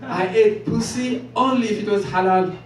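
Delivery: ordinary delivery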